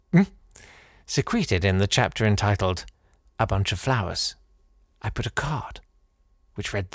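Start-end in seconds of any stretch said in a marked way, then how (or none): 5.0-6.9 soft conspiratorial whisper. A narrator's reading. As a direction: let it slip like a secret. Whispered secret detail.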